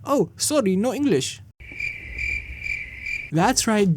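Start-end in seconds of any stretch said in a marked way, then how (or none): 0.0-1.4 higher, put-on foreign accent pretending not to speak English; fake no-English voice. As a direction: play dumb with a foreign accent. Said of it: "I blurted out".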